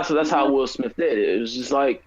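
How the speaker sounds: ordinary talk, nothing marked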